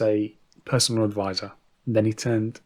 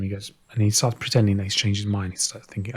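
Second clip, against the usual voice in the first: ordinary delivery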